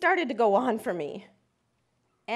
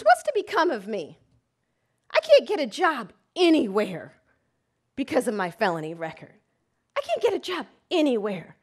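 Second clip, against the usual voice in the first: desperate anger; 5 dB louder than usual. Retold or imitated. Enraged client, desperate.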